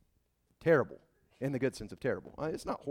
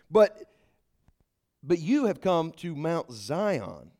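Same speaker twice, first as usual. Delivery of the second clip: ordinary talk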